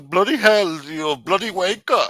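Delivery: high, loud hammed-up British accent; mock British accent. Ranter showing how we'd talk if we lost, mock British accent. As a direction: ham up a British accent for laughs. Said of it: "we'd all be fucking talking like".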